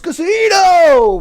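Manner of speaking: high, very drawn-out mock holler; imitated booming call. Retelling the joke's voice to a friend, mock booming call. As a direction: mimic a voice from above, stretching it. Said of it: "he's just".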